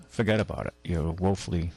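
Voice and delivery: normal talking voice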